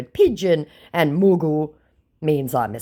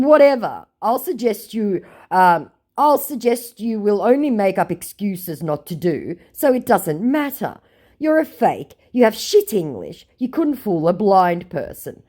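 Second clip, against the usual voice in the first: haughty; 7 semitones higher, 4 dB louder than usual. Retold or imitated. Own reply, high.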